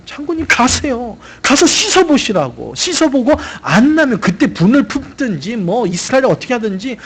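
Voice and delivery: a loyal servant begging the general to just try; pleading servant. Retold or imitated.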